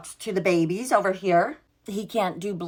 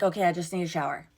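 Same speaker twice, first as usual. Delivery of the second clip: rushed; 2 semitones lower, 4 dB quieter, much faster than usual. Retold or imitated. Boyfriend quoted casually.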